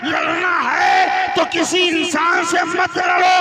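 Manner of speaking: booming high shouted sermon; fiery preaching. Acted. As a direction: preach it at full force. Preaching at full force.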